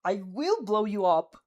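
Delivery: slow, loud snarled threat; growled threat. A narrator's reading. Bakugo threatening to blow you up, slow loud growl. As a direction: spit each word out slowly. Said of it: "he growls".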